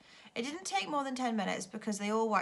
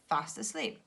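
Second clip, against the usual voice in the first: ordinary delivery, nothing marked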